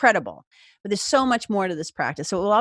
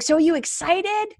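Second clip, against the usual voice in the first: upbeat coach; 8 semitones higher than usual. Retold or imitated. Coach asks, voiced.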